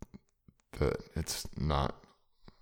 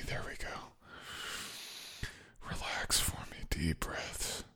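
soothing whisper; 8 dB quieter, much slower than usual. Acted.

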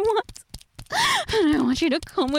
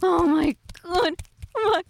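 ordinary talk, nothing marked

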